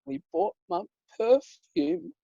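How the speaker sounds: put-on very high, slow cutesy child voice; cutest little-sister voice. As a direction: imitate an adorable little girl, drawn out. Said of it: "said with the cutest voice".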